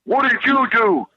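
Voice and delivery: mock yell at the roommate who slept through it; yelling at friend. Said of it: "I'm yelling at Knobs".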